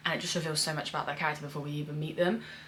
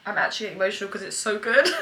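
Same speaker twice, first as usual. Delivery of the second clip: laughing; 3 semitones higher, 8 dB louder, faster than usual. Real, not acted. Overjoyed by the show.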